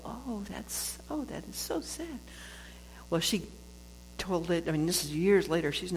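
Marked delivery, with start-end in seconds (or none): 0.0-2.2 high, soft, pitying coo; a hint of listeners cooing with sympathy; mock pity. Listeners react to her abuse story with soft pity. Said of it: "people would go".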